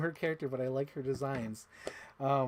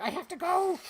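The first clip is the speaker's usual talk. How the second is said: very high, louder mock-distressed cry; exaggerated streamer imitation; 5 dB louder than usual. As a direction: squeal it in comic panic. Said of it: "I'm making it sound funnier".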